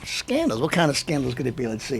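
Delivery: mock innocent hush. Mock innocent musing on scandals.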